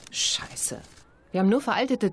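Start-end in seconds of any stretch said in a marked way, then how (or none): 0.1-0.8 unvoiced whispered swear; whispered curse. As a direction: breathe the curse out under your breath. Frustrated aside.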